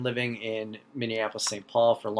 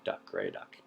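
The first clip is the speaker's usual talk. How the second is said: whispered aside; 11 dB quieter than usual. Shown as a demonstration. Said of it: "the only true way to say it".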